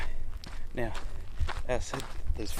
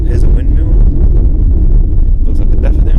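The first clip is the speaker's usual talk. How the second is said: shouting over wind; 2 semitones higher, 20 dB louder, faster than usual. Real, not acted. Still outdoors in strong wind.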